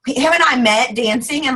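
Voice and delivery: ordinary talk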